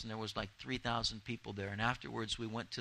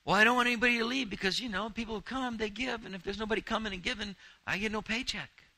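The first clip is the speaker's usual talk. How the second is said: high, fast whiny pastor voice; mock him as a worried, money-minded pastor; mock people-pleasing pastor; 9 semitones higher, 3 dB louder, faster than usual. Preacher mocking a pastor who fears losing his paycheck, high and fast.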